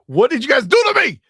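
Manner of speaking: outraged cry. Real, not acted. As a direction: demand an answer from chat. Outraged at chat after mustard.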